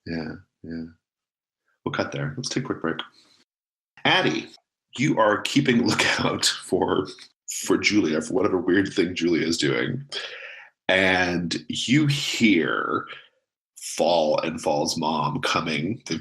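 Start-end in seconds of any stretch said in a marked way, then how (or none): none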